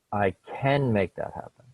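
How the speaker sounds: CAN given the weight; stress on CAN. Shown as a demonstration. Reading the son's sentence, stressing CAN. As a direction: punch the word can. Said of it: "with emphasis in three different places".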